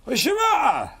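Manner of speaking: high, loud, slurred challenge; confrontational mock shout. Comic as local blocking visitor's way, high loud challenge. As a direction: bellow it high at a passer-by.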